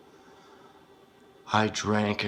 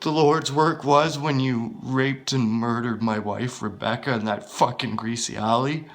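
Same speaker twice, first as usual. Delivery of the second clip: louder, higher, mocking rage; furious taunting; 6 semitones higher, 9 dB louder than usual. Confronting his wife's killer, angry taunting. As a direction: taunt him with fury.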